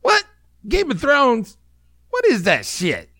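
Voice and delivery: put-on a botched British accent that drifts high and strange; failed British accent. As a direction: attempt a British accent and miss badly. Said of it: "I'm not even gonna try the British accent".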